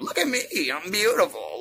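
high, preening mock voice of a vain show-off; vain mockery. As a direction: strut it out, pleased with yourself. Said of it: "walking around like".

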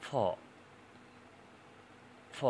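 isolated voiceless "ফ", lip against teeth; labiodental f demo. Shown as a demonstration. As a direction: push air through lip and teeth for "ফ". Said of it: "সাউন্ডগুলো হবে".

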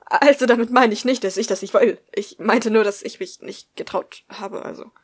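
loud, hurried backpedalling; rushed, flustered speech. A narrator's reading. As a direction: blurt it out in a flustered rush. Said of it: "mit viel zu schneller Stimme".

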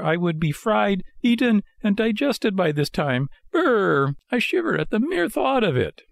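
high-pitched, horrified puppet voice; trembling with fright. A narrator's reading. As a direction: shudder at the thought in a high voice. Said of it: "still trembling with fright".

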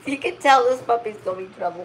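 ordinary delivery

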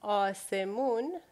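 آسمون as ah-seh-moon with a rising end; Farsi word clearly. Shown as a demonstration. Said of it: "So one more time".

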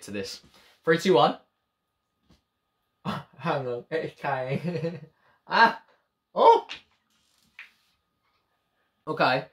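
chewing while laughing; talking after swallowing; mouth full of jelly. Real, not acted. Seen 0: Tips jelly cup into mouth, chews with full cheeks, covers mouth.

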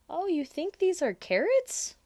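high, loud fake astonishment; mock surprise. Charlie tricks Lola with mock surprise. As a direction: pretend to be amazed.

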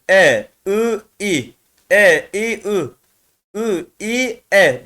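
short syllables E, Ü, İ called out in mixed order; mixed vowel drill. Shown as a demonstration. Teacher reading mixed vowels for students, crisp calls. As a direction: call each vowel crisply with pauses. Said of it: "karışık bile okuyabilirsiniz".

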